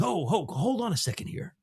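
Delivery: high-pitched impression, stammering interruption; Godfather character. Veteran actor halts the roundtable as his Godfather character. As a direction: imitate an actor slipping into his movie role. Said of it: "his Godfather character".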